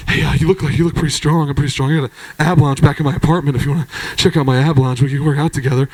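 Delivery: low creepy mumble about his ab lounge; low mumbling. Retold or imitated. Jeff bragging to the comedian about his ab lounge, low mumble. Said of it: "He started mumbling".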